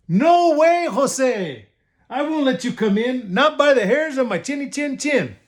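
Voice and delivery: high, defiant little pig. Acted. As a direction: refuse him with high spirit. Pig refuses wolf.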